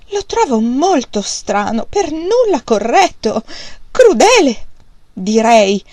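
building from slow complaint to a loud, high outcry; indignant exclamation. A narrator's reading. Mother railing at her husband's choice, rising loud outcry. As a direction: rise to a tearful outburst at crudele. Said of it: "esclamò".

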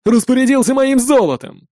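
high, outraged shout; angry cry. A narrator's reading. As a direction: cry out over the stolen gold. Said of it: "крикнул Арви". (возмущённо кричит)

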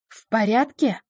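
a mild angry growl. Acted. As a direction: growl it under the breath. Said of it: "прорычал он".

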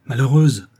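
loud, sudden exclamation; crying out. A narrator's reading. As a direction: cry out in reproach. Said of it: "s'écria-t-il".

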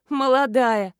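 loud, scoffing echo of the word; amused mockery. A narrator's reading. Neighbour laughs at the stranger's guess, amused mockery. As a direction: throw it back as a joke. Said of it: "рассмеялась соседка".

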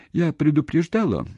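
high, insistent demand for confirmation; high-pitched accusing question; challenging question. A narrator's reading. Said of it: "печально подтвердил он".

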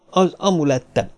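mildly frightened, polite plea; timid faltering. A narrator's reading. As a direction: ask timidly, a touch scared. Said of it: "rebegte Miss Albacre rémülten".

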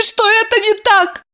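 high, tearful plea, stress on "это"; tearful pleading. A narrator's reading. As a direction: beg with "это" leaned on, near tears. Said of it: "со слезами взмолилась".

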